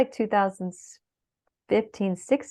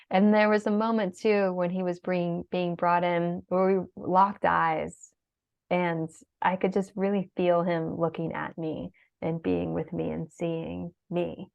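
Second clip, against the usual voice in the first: usual speaking voice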